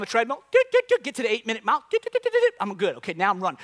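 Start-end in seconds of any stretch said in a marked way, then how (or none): 0.5-1.0 high-pitched imitated button beeps; treadmill beeps. Comedian sets up his treadmill, high imitated beeps. 1.9-2.5 quick string of high beeps; treadmill beeps. Retold or imitated. Comedian punches in treadmill speed, high imitated beeps.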